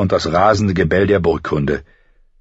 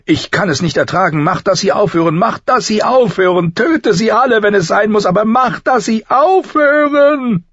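hysterical scream; 5 dB louder than usual. A narrator's reading. Mother who cannot bear the wolves' howling, building hysterical scream. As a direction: scream it desperately, climbing higher and higher. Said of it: "schrie sie".

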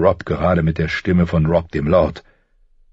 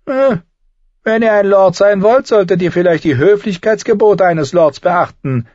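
higher, sly and needling; a higher, sardonic little man's voice; mocking dwarf Tyrion; 14 semitones higher, 6 dB louder than usual. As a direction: talk down to a would-be lord with cool mockery.

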